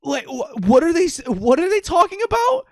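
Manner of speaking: high-pitched, stammering mock bafflement; baffled disbelief. Player reacting jaw-dropped to the conversation, high-pitched bafflement. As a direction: let the question climb very high in disbelief.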